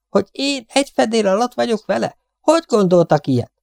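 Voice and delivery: high-pitched affronted disbelief; indignant protest. A narrator's reading. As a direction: protest as if scandalized. Indignant after the shriek. (felháborodott tiltakozás magas hangon)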